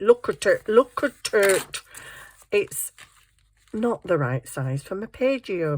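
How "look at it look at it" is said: cooing delight. Real, not acted. Cooing over the page.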